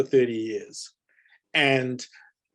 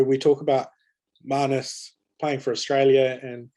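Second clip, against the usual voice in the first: ordinary delivery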